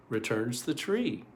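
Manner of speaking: usual speaking voice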